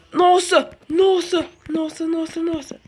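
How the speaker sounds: loud startled exclamations. Real, not acted. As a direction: blurt it out in shock.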